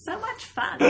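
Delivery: giggling high through the words; laughing. Real, not acted. Giggling joke about lion.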